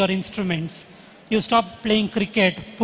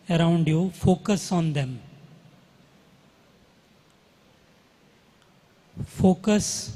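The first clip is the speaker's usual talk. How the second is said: guided meditation; slower than usual. Acted. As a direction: guide the breathing calmly, slowly. Leads a breathing meditation.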